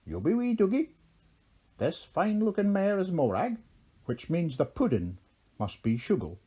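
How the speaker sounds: lilting Irish introductions from a high start; broad Irish accent. A narrator's reading. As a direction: size them up in an Irish brogue. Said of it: "in a very broad Erin accent".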